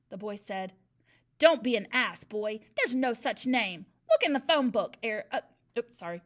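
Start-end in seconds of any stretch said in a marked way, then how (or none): 1.4-3.9 crush him with scorn; bullying scold. A narrator's reading. Headmistress scolds the pupil. 4.1-5.0 loud, blustering headmistress read; wrong voice, Trunchbull's. A narrator's reading. The narrator wrongly reads Eric's line in Trunchbull's booming voice. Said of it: "Wrong person".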